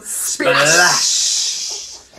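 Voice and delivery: breathy splash noise; whispered splash. Pretend. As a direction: make a splash sound.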